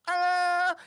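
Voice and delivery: high, loud yell; triumphant shout. Acted. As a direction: shout it with a full mouth. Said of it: "he goes".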